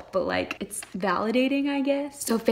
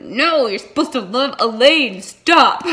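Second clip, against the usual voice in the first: higher, louder objection breaking into a laugh; playful loud protest; 4 semitones higher, 7 dB louder, slower than usual. Acted. Reader scolds a character for loving wrongly, playful loud protest. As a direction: protest in mock outrage.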